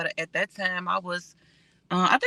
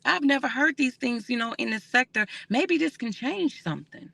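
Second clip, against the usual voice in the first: higher, gushing voice of an amazed new viewer; impressed newcomer; 6 semitones higher than usual. Retold or imitated. Imitating viewers from other areas discovering her channel, higher and amazed. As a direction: play a stranger who just found the channel and is inspired, pitched up. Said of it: "they're gonna say".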